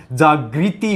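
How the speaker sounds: जागृति slowly as jaag-ri-ti with a clear ri; correct pronunciation. Shown as a demonstration. Teacher slowly models the correct form for students. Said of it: "बल्कि क्या होता है?".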